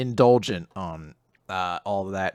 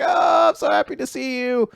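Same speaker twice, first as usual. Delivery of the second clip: overjoyed dad; 12 semitones higher than usual. Retold or imitated. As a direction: gush like a delighted dad seeing his son. Dad greets returning son.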